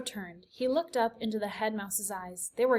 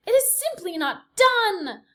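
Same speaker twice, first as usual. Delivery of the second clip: high, loud, slow disapproving aunt; prim Aunt Florence; 11 semitones higher, 9 dB louder than usual. A narrator's reading. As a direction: sniff it out like a scandalized aunt. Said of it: "distinctive voice of Despereaux's Aunt Florence".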